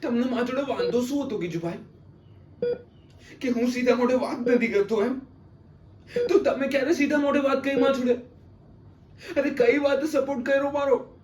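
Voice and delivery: high-pitched, strained confrontation; anguished reproach. Acted. Son confronting his silent father over old hurts, high-pitched reproach.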